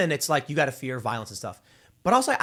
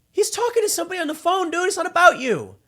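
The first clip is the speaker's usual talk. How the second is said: high-pitched, incredulous protest, louder at the end; exasperated; 4 dB louder than usual. Acted. Telling a woman the guy is just on the phone, exasperated. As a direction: squeal it in mock exasperation.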